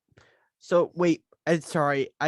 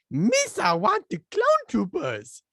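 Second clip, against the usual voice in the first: high, slow cartoonish alien voice; Jar Jar Binks impression; 10 semitones higher, slower than usual. Podcaster imitates Jar Jar asking for troopers, high and slow. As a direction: stretch it out high like a goofy alien sidekick. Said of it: "a really good Jar Jar Binks impression".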